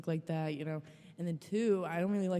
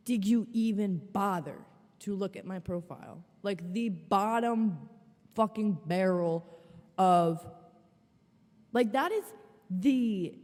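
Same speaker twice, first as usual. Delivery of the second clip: indignant rant, slow and punchy; 3 dB louder than usual. Real, not acted. Vlogger scolding the match for ignoring her profile, slow indignant rant. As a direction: drag out bottom fucking barrel, louder. Ranting at the guy.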